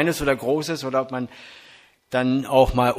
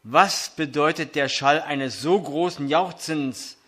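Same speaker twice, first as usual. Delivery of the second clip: scripture reading; 3 semitones higher than usual. Acted. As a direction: proclaim the text to the congregation. Reads 1 Samuel 4 aloud.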